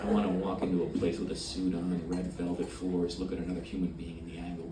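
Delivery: usual speaking voice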